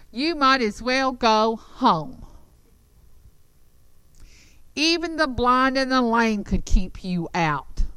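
slow, mocking taunt from the walls. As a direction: sneer at the invaders, slowly. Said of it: "when I'm doing that taunting".

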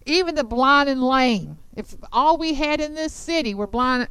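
mock them as worthless; taunting. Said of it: "when I'm doing that taunting".

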